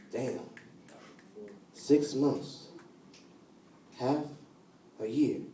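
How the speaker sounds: hushed regret. Acted. Poet dwelling on only six months of love, hushed regret. Poem dwells on short romance.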